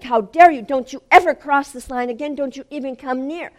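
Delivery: fierce command to stay back; shouted rebuke. Retold or imitated. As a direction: shout an indignant warning. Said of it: "I'm shouting at him".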